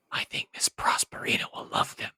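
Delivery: hushed confiding whisper. Acted. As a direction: let the little boy in on a secret. Aside to the child.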